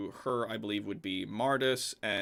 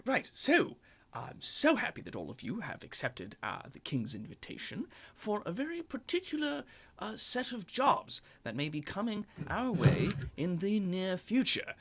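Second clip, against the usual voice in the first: a hint of a formal host, pitched up; lightly voiced Benjamin; 10 semitones higher than usual. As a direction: announce the job graciously. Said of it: "is just like".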